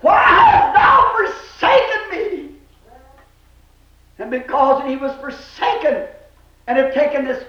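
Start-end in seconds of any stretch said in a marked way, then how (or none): 0.0-2.5 high, slow anguished shout; drawn-out, high-pitched pulpit cry. Acted. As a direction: cry out to God as one forsaken. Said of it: "that's why he cried".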